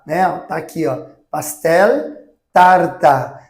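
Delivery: normal talking voice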